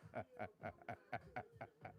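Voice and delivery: very soft, real chuckling; laughing. Real, not acted. Amused after teasing Sonya, soft laughter. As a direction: laugh quietly at your own bit. Cracks up after accent act.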